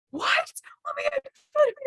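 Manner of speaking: very high, squealed amazement; re-enacted squeal of disbelief; imitated astonishment. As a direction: react with high-pitched mock shock. Husband's astonishment, retold.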